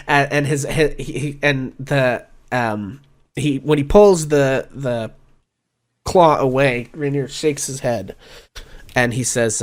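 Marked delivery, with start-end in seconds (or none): none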